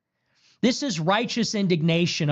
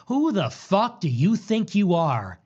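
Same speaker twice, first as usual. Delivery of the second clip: ordinary delivery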